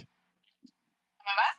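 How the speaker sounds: tinny radio filter on the voice; like talking through a walkie-talkie. Real, not acted. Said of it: "you're coming from a radio, like a walkie-talkie".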